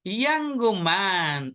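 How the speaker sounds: slow, stretched young man; drawn-out example. Shown as a demonstration. Teacher presenting the next example to the class, drawn out. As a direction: draw out both words of young man.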